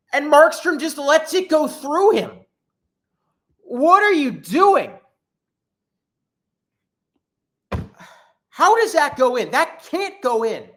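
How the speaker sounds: high, loud, incredulous outburst at the goalie; disbelieving fan yelling at the screen; exasperated rant. Real, not acted. Fan ranting at goalie's soft goal.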